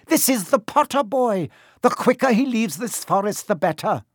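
high, insistent centaur voice; defiant centaur. Acted. Centaur urging that the boy leave quickly, defiant.

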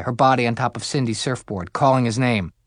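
ordinary delivery

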